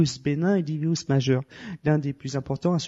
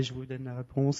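ordinary talk, nothing marked

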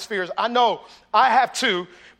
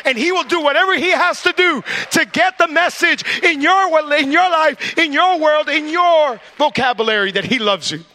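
climbing higher and louder to a peak; preaching build; 5 semitones higher, 6 dB louder than usual. Acted. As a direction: drive it home to the congregation.

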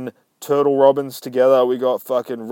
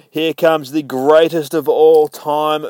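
ordinary talk, nothing marked

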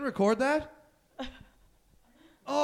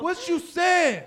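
drawn-out taunt; 8 semitones higher, 3 dB louder, much slower than usual. Acted. Teasing drawn-out question.

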